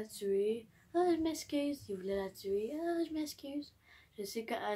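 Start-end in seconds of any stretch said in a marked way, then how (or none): 0.9-1.9 high-pitched little "sorry"; oops, sorry, said high; mock apology. Mock apology for spoiling the plot. 2.8-3.7 high-pitched little "sorry"; oops, sorry, said high; mock apology. Same mock apology, repeated.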